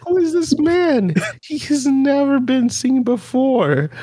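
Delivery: high, put-on incredulous voice, slowing down; mock baffled disbelief. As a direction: squeal it like you can't believe a stranger just walked in. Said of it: "And I'm like".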